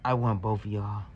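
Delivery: low, hushed whisper. Retold or imitated. Woman whispering a come-on across the table. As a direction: breathe it under the talk. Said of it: "I heard her whisper".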